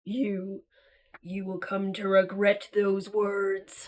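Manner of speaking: low, slow villain voice, looming over the heroes; menacing emissary. Acted. Dark emissary warning the trapped explorers, low and menacing. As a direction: speak down to them like a sinister dark lord.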